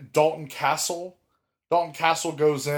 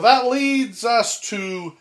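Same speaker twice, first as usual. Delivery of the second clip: dramatic announcer reveal; 6 semitones higher, 7 dB louder, slower than usual. Acted.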